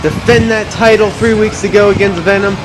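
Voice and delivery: high, hyped ring announcer voice; wrestling promo. Acted. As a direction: sell it like a big fight night.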